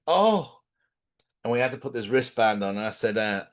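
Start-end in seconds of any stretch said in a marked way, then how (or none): 0.1-0.6 high startled oh; hammed-up gasp of fright; mock fright. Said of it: "One of them was obviously like".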